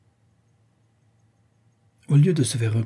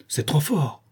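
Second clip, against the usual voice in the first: mildly indignant, pitch lifted; indignant exclamation; 4 semitones higher, slower than usual. A narrator's reading. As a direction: exclaim in restrained protest. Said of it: "s'écria Maretsky". (exclamation un peu indignée)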